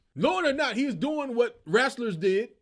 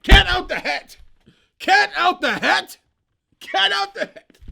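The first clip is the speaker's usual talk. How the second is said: higher and much louder, calling it like a show title; announcer shout; 7 semitones higher, 8 dB louder than usual. Shown as a demonstration. Vlogger pitching the podcast name to Cat, shouted like an announcer. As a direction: hype the podcast name like a big reveal. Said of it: "Name of your podcast should be".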